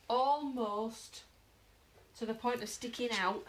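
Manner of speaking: usual speaking voice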